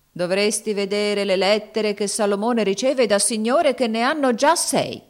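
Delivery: put-on pointed, scornful boy; bitter irony. A narrator's reading. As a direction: throw it back with bitter irony. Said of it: "replicò Peter con amara ironia".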